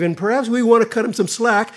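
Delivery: normal talking voice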